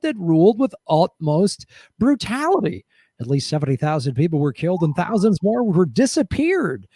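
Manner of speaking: game show announcer reading out a grim list; punchy host voice, key names stretched. Acted. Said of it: "read about death squads in the game show voice".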